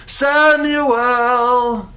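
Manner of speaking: high, long-drawn call of a name. Acted. Voice calls sleeping boy in the night, drawn-out high call. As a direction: call the boy from afar, drawing out each syllable. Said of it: "heard his name being called".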